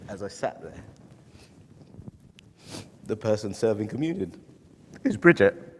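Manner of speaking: choking up, fighting real tears mid-sentence; crying. Real, not acted. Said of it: "And I don't know why I'm crying".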